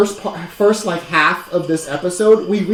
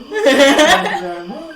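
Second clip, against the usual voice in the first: slow Southern drawl, laughing, higher; southern accent; 5 semitones higher, slower than usual. Acted. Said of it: "I got a southern accent over here".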